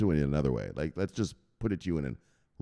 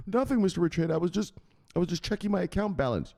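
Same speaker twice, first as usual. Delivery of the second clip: high, pleading mock-timid voice; nervous underling; 10 semitones higher, faster than usual. Caught underling explaining to Mister Richeda, nervous and high. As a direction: make a guilty excuse in a raised voice.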